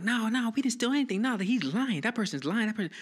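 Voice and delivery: high, loud, fast denial; heated protest. Retold or imitated. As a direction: kid protesting his innocence, pitch way up. Retold argument with the cop.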